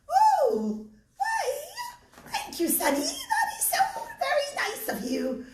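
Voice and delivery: put-on high, wavering old lady voice; grateful little old lady; old woman. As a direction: creak up high like a grateful granny. Old Roman woman accepts help.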